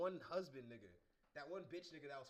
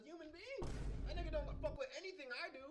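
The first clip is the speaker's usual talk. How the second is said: exasperated rant; 13 semitones higher, 7 dB louder than usual. Real, not acted. Ranting about hating uncle.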